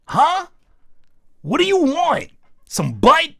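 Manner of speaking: high, loud, pushy woman's voice; impatient woman. Acted. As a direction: press him with loud, high questions. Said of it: "she finna be like".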